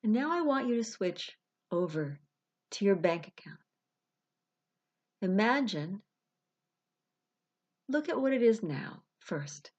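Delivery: unhurried meditation voice with long pauses; guided visualization. Acted. Life coach guiding listeners to release money worries, guided meditation.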